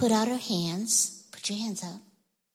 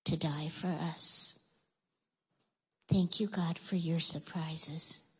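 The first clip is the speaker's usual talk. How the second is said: quiet prayer; 4 semitones lower, 7 dB quieter than usual. Acted. Pastor praying over the children at the end, soft and hushed. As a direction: speak to God gently and gratefully, near a whisper at times.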